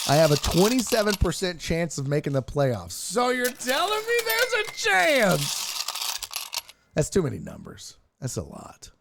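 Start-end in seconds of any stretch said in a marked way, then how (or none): 2.9-5.6 high, drawn-out mock hopeful cry; hopeful movie quote. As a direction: stretch it out high with mock amazed hope. Jokey movie line quote.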